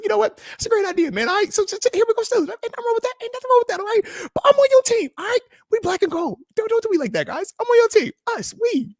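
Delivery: high, hammed-up pleading; high mock-pleading reply. Pleading with the chat that he's on their team, high and squeaky.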